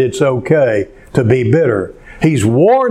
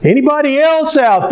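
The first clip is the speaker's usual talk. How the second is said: acted calling out; 12 semitones higher than usual. Hanging man seeks another rescuer after God's answer, high acted call. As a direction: call past God for someone else, pitched high.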